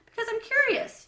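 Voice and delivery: bursting with curiosity; eager. A narrator's reading. Israel eager.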